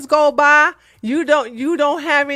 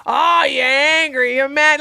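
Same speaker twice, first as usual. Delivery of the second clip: mock gaslighting. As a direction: drag it out like he's belittling you. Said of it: "here's the gaslighting".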